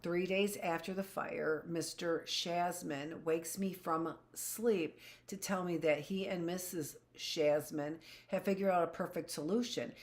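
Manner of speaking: usual speaking voice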